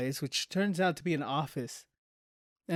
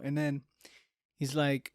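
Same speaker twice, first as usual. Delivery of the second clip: normal talking voice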